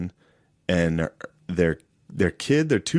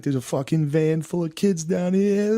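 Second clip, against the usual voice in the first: high excited Long Island voice; panicked Long Island witness; Long Island accent; 9 semitones higher than usual. Retold or imitated. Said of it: "they have that Long Island accent".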